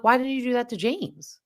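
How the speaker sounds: indignant mock question. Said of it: "and I'm like".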